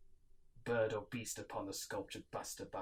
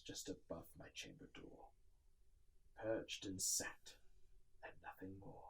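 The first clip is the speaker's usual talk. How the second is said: unvoiced, hushed whisper; 4 semitones lower, 11 dB quieter than usual. A narrator's reading. Narrator tells of the raven perched, whispering. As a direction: let it die to a whisper.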